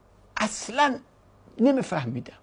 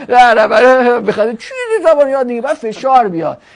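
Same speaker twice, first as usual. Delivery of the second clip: mock scolding; 7 dB louder than usual. Scolding a lazy learner.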